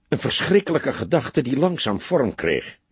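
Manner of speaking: ordinary delivery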